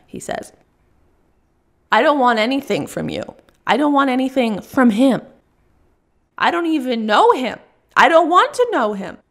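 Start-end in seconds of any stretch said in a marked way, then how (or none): 1.9-9.2 put-on builds from firm to much higher and louder, peaking on boyfriend; rising fury. Russian woman declaring she has a boyfriend. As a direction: escalate until you declare it. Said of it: "she declares".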